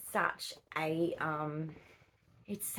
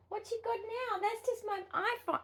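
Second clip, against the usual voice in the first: much higher, sing-song pet voice. Real, not acted. Owner cooing to the curious kitten, high baby talk. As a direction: coo at the kitten.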